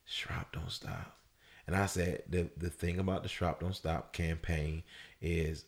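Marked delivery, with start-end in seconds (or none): 0.1-1.2 slow, soft whisper; hushed resolve. Retold or imitated. Man tells himself to resume after regaining weight, slow hushed whisper. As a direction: whisper it slowly, as if to yourself.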